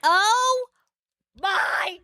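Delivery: rising shocked gasp into a shout; stunned, then cry it out. Real, not acted. Shocked reaction to cruelty.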